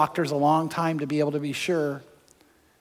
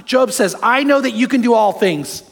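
loud, high, driving sermon voice; full-force preaching; 4 semitones higher, 5 dB louder than usual. Acted. Pastor preaching God's power to the congregation, loud and driving. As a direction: preach it out to the whole room.

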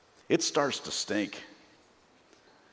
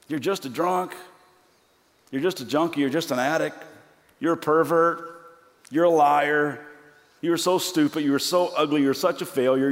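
taunting accuser; 5 semitones higher, 5 dB louder than usual. Acted. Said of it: "he kind of whispers".

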